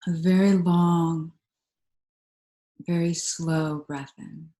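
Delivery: calm, unhurried meditation voice with long pauses; guided meditation. Real, not acted. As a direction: guide them gently into stillness, leaving space between phrases.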